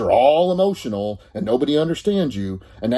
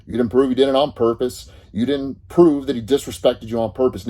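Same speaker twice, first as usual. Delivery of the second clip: normal talking voice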